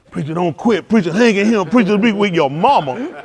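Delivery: loud mock pep talk, building; mock encouragement. Preacher mimics supporters cheering him on, loud mocking encouragement. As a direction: imitate over-eager well-wishers, loudly.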